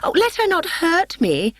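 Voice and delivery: high, fearful screech; timid woman screeching in fright; frightened screech. A narrator's reading. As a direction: cry out for protection. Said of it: "screeched Helena".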